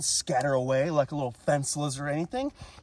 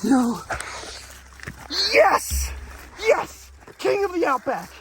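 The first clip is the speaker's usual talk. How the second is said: high triumphant shouts; thrilled catch, yelling yes; excited yelling; 4 dB louder, slower than usual. Real, not acted. Catching the lizard.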